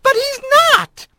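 very high, indignant interruption; outraged squirrel magistrate cutting in; heated protest. Acted. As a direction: burst in with a high-pitched objection. Magistrate interrupts colleague in quarrel.

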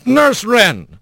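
formal courtroom lawyer; crisp questioning attorney. A narrator's reading. Lawyer addresses witness.

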